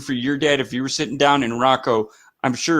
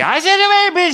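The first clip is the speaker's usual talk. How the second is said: shrill high squealed Asuka line, much higher and louder; Asuka impression; 6 dB louder than usual. Mimicking Asuka's wrestling promo, very high and loud. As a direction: shriek it like Asuka cutting a promo. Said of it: "Or Asuka just say".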